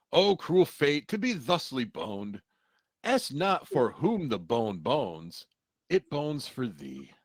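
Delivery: slow, grand tragic recital; hammy stage actor. Retold or imitated. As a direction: declaim it like an overblown Shakespearean actor. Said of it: "Bender quotes today".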